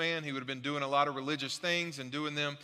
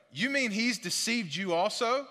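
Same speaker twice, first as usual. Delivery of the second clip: higher, sneering retort; Pharisees retorting; 5 semitones higher than usual. Acted. Pharisees retort to the guards with a raised sneer. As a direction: throw the question back scornfully. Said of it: "the Pharisees retorted".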